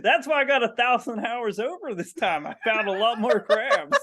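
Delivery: hammed-up proud rural crab counter reporting. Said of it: "with that accent".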